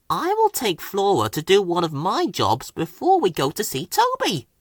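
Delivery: a little plotting glee; chuffed to himself. A narrator's reading. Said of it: "he chuffed to himself".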